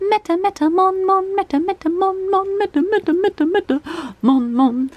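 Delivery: lightly voiced sing-song granny; singsong like a doting grandma. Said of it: "I would switch into this grandma voice".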